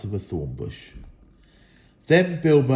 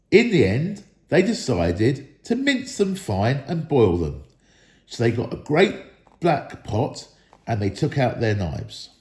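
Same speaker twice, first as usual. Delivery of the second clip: usual speaking voice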